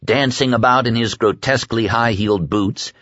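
usual speaking voice

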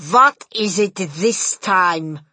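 loud, slow witch's shout in a strange voice. A narrator's reading. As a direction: bark it out slowly as the Grand High Witch. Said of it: "shouted the Grand High Witch".

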